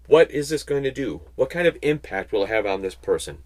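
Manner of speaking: normal talking voice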